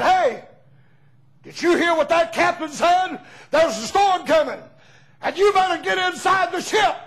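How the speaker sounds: high, loud warning call to a passenger. Retold or imitated. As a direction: shout over the wind to get her inside. Deckhand calling out over storm.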